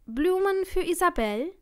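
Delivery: high, lilting delivery call; gespielt freundlicher Bote. A narrator's reading. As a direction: sing out a sweetly menacing question. Stalker poses as delivery man. (scheinheilig freundlich, mit hoher Stimme)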